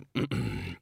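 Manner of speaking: low, hesitant throat-clear; stalling little ahem; awkward throat clearing. Acted. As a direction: clear the throat before an embarrassing suggestion.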